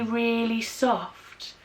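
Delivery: ordinary talk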